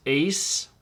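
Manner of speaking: ace said slowly and clearly; slow model word. Shown as a demonstration. Teacher models ace slowly after explaining the diphthong. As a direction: hold out ace so they hear the vowel. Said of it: "The epsilon iota is pronounced a".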